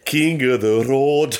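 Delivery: high, drawn-out sung line in mock Scottish; hammed-up Scottish singalong accent; attempted Scottish accent. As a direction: sing it slowly in a would-be Scottish accent. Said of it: "pretty good Scottish accent I did there".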